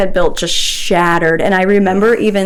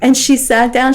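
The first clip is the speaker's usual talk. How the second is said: usual speaking voice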